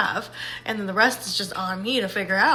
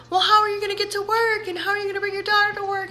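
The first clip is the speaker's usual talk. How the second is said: worried coworker; 9 semitones higher, faster than usual. Retold or imitated. Coworker voiced fretting over rides.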